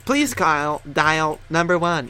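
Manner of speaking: slow robotic phone menu voice; automated voice. Acted. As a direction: read it like a phone system. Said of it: "that sounded like an automated voice".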